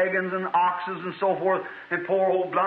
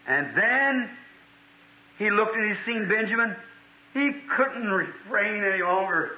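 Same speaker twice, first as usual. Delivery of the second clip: ordinary talk, nothing marked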